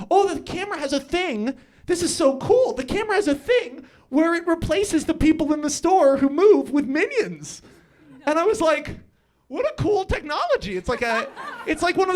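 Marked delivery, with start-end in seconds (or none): 0.1-7.6 higher, louder, giddy wonder; tripping and amazed; mock delight. Retells his delighted high thought. 9.5-10.8 high, wide-eyed wonder; gushing delight; mock awe. Retold awe on Molly.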